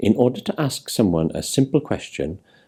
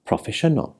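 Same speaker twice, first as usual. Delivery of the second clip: Proffesiynol with the next-to-last syllable, "siy", emphasised; stress on second-last syllable; 5 semitones higher, 3 dB quieter than usual. Shown as a demonstration. Teacher demonstrating Welsh word stress, Proffesiynol stressed on its second-last syllable. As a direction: stress the syllable before the final one in Proffesiynol. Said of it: "The last syllable but one is emphasised".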